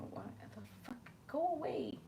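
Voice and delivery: quick whispered curse, shooing; whispering. Real, not acted. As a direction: hiss it under your breath.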